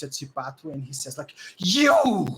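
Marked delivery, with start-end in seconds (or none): 1.6-2.3 loud, high furious yell; acted outburst of anger; very mad. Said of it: "And he's very mad".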